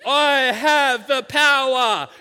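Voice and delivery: huge, slow, high shout; hammed-up heroic bellow; He-Man battle cry. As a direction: bellow it like a cartoon hero raising a sword. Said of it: "I held aloft my magic sword and I said".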